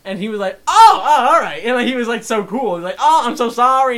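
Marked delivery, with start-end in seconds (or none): 0.7-1.6 loud, high imitated "oh"; surprised agreement. Customer readily agreeing to the mask request, loudly surprised. 3.0-4.0 loud, high mock apology; effusive apology. Customer apologising to the store worker, loudly effusive.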